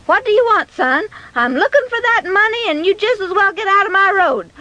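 high, irritated mother's voice; acted frazzled scolding; exasperated mother. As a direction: brush the boy off impatiently. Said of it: "She was just at her wits' end".

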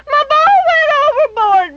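put-on high wailing child's voice, falling at the end; the boy repeats his wail, sinking down at the end; crying little boy. A narrator's reading. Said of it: "Benny cried".

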